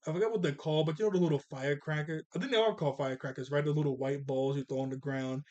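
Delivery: normal talking voice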